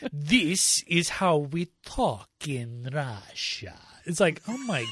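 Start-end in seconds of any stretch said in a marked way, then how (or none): 0.1-3.7 slow, deliberate fake Russian accent; bad Russian accent. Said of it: "the worst Russian accent I've ever heard".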